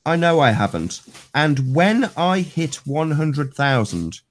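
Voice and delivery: slow, stressing "know" and "when"; sarcastic dramatic rereading; emphatic reread. Shown as a demonstration. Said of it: "I'll say it one more time".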